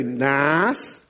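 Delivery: "nice" drawn out in a Southern accent. Shown as a demonstration. Preacher showing how he coached an English friend, Southern accent. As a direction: say "nice" with a stretched Southern vowel. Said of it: "teach him to speak Southern".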